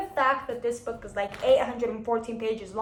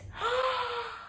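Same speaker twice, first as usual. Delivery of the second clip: a sharp, high, delighted gasp; high thrilled gasp; 12 semitones higher, 4 dB quieter than usual. Acted. Delighted by the blurb, a high excited gasp.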